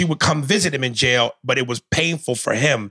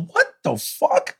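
drawn-out, incredulous outburst; stunned disbelief; 4 semitones higher, slower than usual. Retold or imitated. Viewer reacting to the cut-off ending, stunned disbelief. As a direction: stretch it out, dumbfounded.

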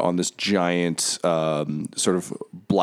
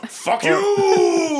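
high screaming villain outburst; furious shout; 4 dB louder than usual. Acted. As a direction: scream it at him. Villain refuses to give sword.

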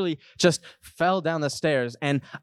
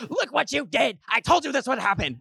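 high mock scolding shriek; mom's shriek; 11 semitones higher than usual. Mom seems to yell at the dog, mock shriek. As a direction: scold in a shrieky, much higher voice. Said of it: "I hear my mom shriek".

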